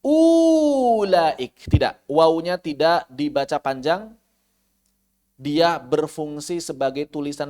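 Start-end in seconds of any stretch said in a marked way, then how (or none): 0.1-1.7 "ulaik" with the waw drawn out long on a high falling tone; mispronounced long mad; wrong long reading. Shown as a demonstration. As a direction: stretch the middle vowel as a mistake. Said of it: "Maka jangan dibaca".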